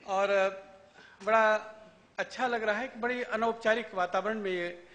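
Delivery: usual speaking voice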